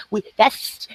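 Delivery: normal talking voice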